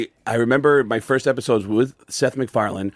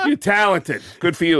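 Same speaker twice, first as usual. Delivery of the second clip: higher-pitched celebrity impression; Christopher Walken; 5 semitones higher than usual. Comic retelling faint praise he received, as Christopher Walken. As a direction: imitate him in short, separated phrases. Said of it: "Christopher Walken just went".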